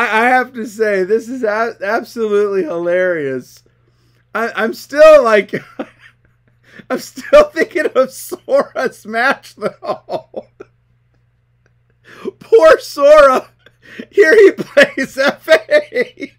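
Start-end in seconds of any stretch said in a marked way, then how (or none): 6.2-16.4 real laughter breaking into drawn-out words; loud, higher, nearly crying with laughter; laughing to tears. Real, not acted. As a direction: struggle to talk while cracking up. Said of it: "I'm almost crying over here".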